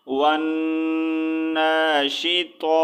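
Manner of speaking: ون ناشطا with nasal held n, long "naa" and "taa"; very slow recited "wannn-naa-shi-taa" for ون ناشطا; stretched long vowels. Shown as a demonstration. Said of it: "ایک second کے لیے جو ہے stretch کرنا ہے".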